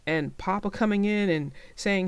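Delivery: plain reading voice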